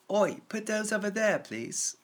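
high "Oi" cockney-ish English call; English accent, high and cheeky on "Oi". Retold or imitated. Showing how English people say it, English accent. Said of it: "in England".

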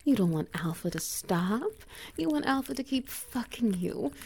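ordinary talk

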